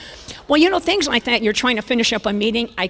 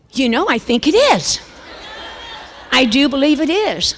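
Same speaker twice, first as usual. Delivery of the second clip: raised, louder feigned discovery; mock innocence; 4 semitones higher, 5 dB louder than usual. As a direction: play caught out, acting innocent. Her caught-out reply to husband.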